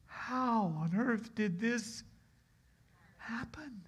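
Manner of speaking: high, slow astonished question, fading; amazed wife. Acted. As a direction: ask it in slow disbelief. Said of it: "what she must have asked".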